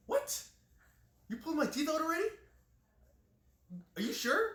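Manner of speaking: loud, high-pitched mock disbelief; retold shock. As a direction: act out a stunned patient's incredulous questions, voice jumping up. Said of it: "he was actually shocked".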